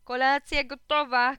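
mum's high, loud, drawn-out call; calling out loudly. A narrator's reading. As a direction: call the family to supper. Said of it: "krzyknęła mama". (mama głośno i przeciągle woła)

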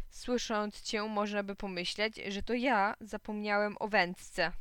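plain reading voice

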